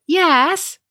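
high, emphatic imitation; retold eager yes. Phone agent confirming the small talk, high eager imitation.

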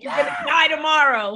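laughing, loud mock alarm; mock horror. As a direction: laugh while shouting it like a horrified teen. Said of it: "forty is like".

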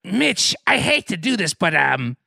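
high-pitched ghoulish roommate; Crypt Keeper. Acted. Ghoul asks roommate to clean up, Crypt Keeper voice. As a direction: ghoul voice, high and put-on, politely complaining. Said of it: "this is a neat freak, the Crypt Keeper".

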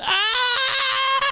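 high mock shout of disgust; class shout. Retelling the class reacting to him returning, mock yell. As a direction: yell it like a whole class recoiling. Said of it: "the whole class went".

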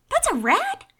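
high, loud disbelief; can't believe what you see; shocked. Real, not acted. Disbelief at huge rat.

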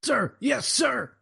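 high, timid recruit reply; barked sir yes sir. Pretend. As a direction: answer like a nervous recruit. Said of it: "I can't hear you".

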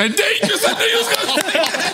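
high laughing tease. Real, not acted. Laughing at predicted answer.

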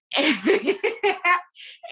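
bright burst of laughter; hearty laugh. Real, not acted. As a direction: laugh out loud at the nickname. Laughing at the Milkshake story.

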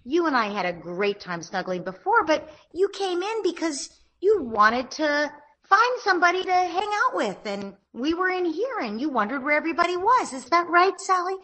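put-on parent talking to child. Shown as a demonstration. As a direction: lift way up and coo to the child. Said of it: "And then".

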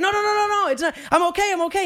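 mock-frantic denial, begging to stay; freaking out. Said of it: "he starts freaking out".

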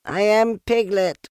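loud high insistence; loudly. A narrator's reading. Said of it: "said Piglet loudly".

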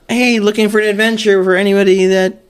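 high, loud, hammed-up pitch; exaggerated attention-grabbing announcement; mock profile headline. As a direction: call it out like a cheesy personal ad. Acts out a dating profile headline.

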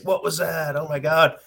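high startled quote; mock panicked neighbor; alarmed neighbor. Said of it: "people like".

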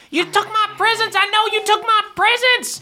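very high-pitched little elf squeal; accusing crick elf. Acted. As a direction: squeal the accusation as a tiny creature. Crick elves fighting over stolen presents.

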